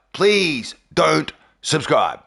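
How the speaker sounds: "Please don't subscribe" drawn out slowly, word by word; slow emphatic plea. Real, not acted. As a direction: stretch "Please", pause, then "don't subscribe".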